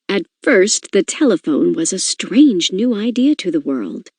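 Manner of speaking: ordinary talk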